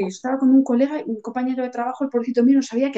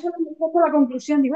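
ordinary talk, nothing marked

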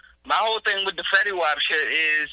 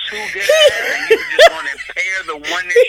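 higher, louder, laughing as the words spill out; laughing through the roast; 8 dB louder than usual. Real, not acted. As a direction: crack up while making the point. Cracking up mid-roast.

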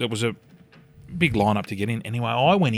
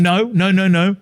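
higher, louder mock-panicked denial; hasty denial; 7 semitones higher, 7 dB louder than usual. Dad denying to his daughter that he's high, hasty denial. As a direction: protest it loudly, pitched up.

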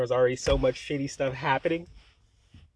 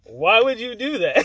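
1 exasperated; 9 semitones higher, 10 dB louder, slower than usual. Retold or imitated. Exasperated at her.

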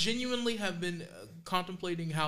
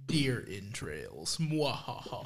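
deep, slow villainous voice with cackle; mad scientist; 9 semitones lower, much slower than usual. Pretend. Spooky joke about deer guts.

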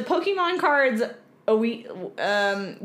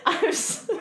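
laughing; 10 semitones higher, slower than usual. Real, not acted. Giggling fit.

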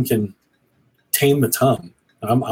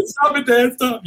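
high mock whine of an embarrassed teen son; whiny teen son; 11 semitones higher, slower than usual. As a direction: squeak it like a kid pushing dad away. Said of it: "he's like".

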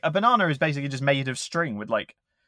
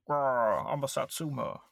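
soft growly cartoon fighter; growling fruit voice; 6 dB quieter, slower than usual. Voicing satsuma fighter.

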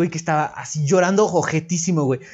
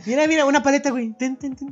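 very high sing-song coaxing; high-pitched coaxing; mock coaxing; 11 semitones higher, faster than usual. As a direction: dangle a lollipop at a crying kid. Offering candy to crying kid.

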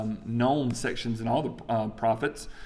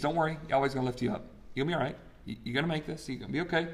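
softer, gentle comfort; reassuring; 7 dB quieter, much faster than usual. Acted. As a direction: soothe the weeping prophet. Name meaning as comfort.

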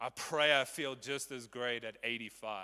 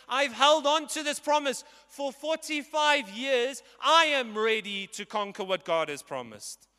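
bold declaration; 12 semitones higher, 11 dB louder than usual. Acted. Preacher voicing Caleb's bold declaration.

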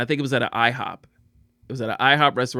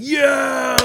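high, drawn-out shouted hail; over-aggressive greeting; 11 semitones higher than usual. Retold or imitated. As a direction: holler it like an overexcited gym bro greeting. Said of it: "they could be extra aggressive".

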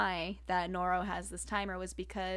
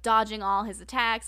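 ordinary talk

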